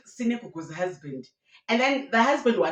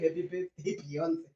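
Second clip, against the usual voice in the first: much quieter repeat of the birthday wish; softly echoed greeting; 6 dB quieter than usual. Acted. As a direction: echo the greeting under your breath.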